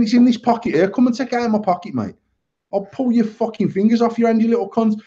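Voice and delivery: reliving the fury, pitch up; retold threat. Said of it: "I've ended up properly abusing him".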